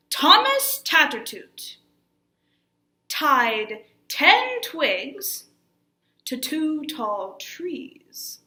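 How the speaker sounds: big theatrical opening, slowing to drawn-out words; very dramatic. Shown as a demonstration. Teacher redoing the shorter warm-up line, very dramatic. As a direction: launch it loud and high, then stretch each word. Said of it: "very dramatic way".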